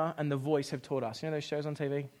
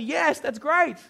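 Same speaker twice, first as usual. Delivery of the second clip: ordinary delivery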